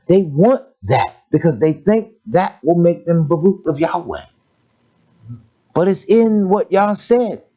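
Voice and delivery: rising full-force sermon cry; preaching. Acted. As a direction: preach it to the congregation with fire.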